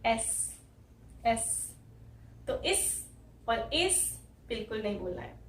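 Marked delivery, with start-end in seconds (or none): 0.1-1.8 "एस" ending on a clear s; letter S. Shown as a demonstration. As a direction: say "एस" crisply. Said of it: "स आ जाएगा".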